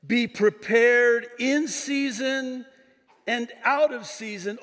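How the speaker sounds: high, stretched-out preaching call; emphatic proclamation. Shown as a demonstration. Pastor reading Paul's charge to Timothy, high emphatic proclamation. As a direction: proclaim it with drawn-out force.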